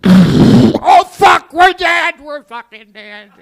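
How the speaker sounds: high, very loud panicked shriek; panicked scream. Retold or imitated. Kids hear gravel under tires, hammed-up terrified shouting. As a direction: scream as if doom is seconds away.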